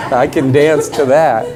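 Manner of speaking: real laughing delight. Real, not acted. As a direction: say it mid-laugh. Still laughing at mantra.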